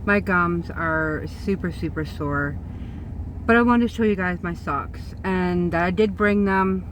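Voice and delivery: toothless mumble, no teeth in. Real, not acted. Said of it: "I don't have my teeth in".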